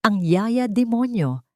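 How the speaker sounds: ordinary delivery, nothing marked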